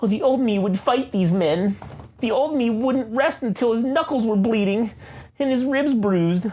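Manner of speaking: martyr Daredevil. Acted. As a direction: play the tragic martyr, grand and mournful. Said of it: "oh, martyr Daredevil".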